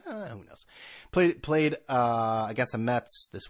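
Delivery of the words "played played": usual speaking voice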